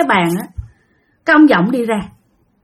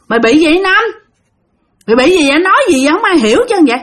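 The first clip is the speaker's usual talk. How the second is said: demanding shout; 5 semitones higher, faster than usual. Retold or imitated. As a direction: press him for an answer. Buddies demand answers from Năm.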